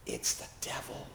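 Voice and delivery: breathy hushed whisper to herself. Retold or imitated. Chaplain whispering to herself about the wild man in fear. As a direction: whisper it fearfully under her breath. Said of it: "I whispered to myself".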